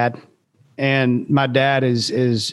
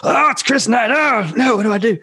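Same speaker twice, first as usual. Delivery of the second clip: higher, flustered fan panic; starstruck panic; 8 semitones higher than usual. Retold or imitated. Young singer panicking at spotting his hero, higher and flustered. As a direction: squeak it out like a nervous fan. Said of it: "I'm like having this".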